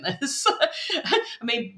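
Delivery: laughing while talking; a light laugh. Real, not acted. Laughing at own remark.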